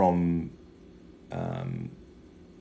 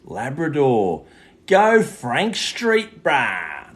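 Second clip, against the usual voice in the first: mock local bogan shout; 6 semitones higher, 9 dB louder, much slower than usual. Mock local hype callout.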